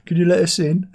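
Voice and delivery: mocking the calm British drugs raid; polite police knock. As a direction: ask sweetly like a very courteous copper at the door. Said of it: "Just very calm".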